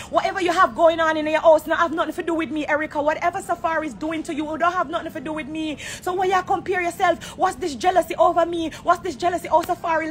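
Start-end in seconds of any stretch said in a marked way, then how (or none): none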